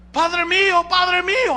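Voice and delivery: high, loud call across the distance. Retold or imitated. David calling down to Saul from the heights, high and loud. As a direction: cry out to him from up high. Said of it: "y le grita".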